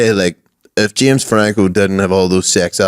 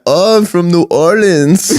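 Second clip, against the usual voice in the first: high and slow Wiseau voice; Tommy Wiseau impression; 7 semitones higher, slower than usual. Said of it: "your man from The Disaster Artist".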